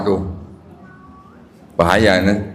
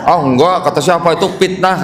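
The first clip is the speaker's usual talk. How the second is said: higher-pitched joking Sundanese protest, F turned to P; Sundanese accent; 6 semitones higher than usual. Retold or imitated. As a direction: deny it in a Sundanese accent, saying "pitnah". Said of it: "orang Sunda nggak bisa ngomong F". (logat Sunda, F jadi P)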